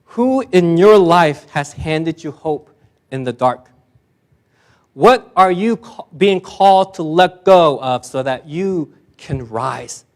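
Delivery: normal talking voice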